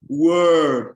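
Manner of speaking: "word" loud, long and falling; stress on word. Shown as a demonstration. Lecturer showing students emphasis, "word" stressed loudly. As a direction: punch and stretch "word". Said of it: "I'm stressing now".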